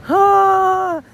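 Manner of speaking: a sudden high, loud ah as feeling wells up; real emotion. Real, not acted. Fan recalling the Irish flag on the leaderboard, overcome. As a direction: let the pride catch in your throat. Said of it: "I'm getting emotional just thinking about it".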